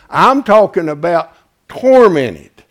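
slow, climbing to a loud, drawn-out cry; sermon climax, slow and rising to a shout; building preaching. Acted.

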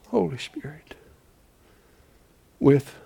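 breathed in awe; hushed holy whisper; reverent whisper. Acted. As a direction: let it drop to a whisper. Reverent hush.

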